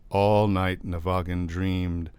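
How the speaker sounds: plain reading voice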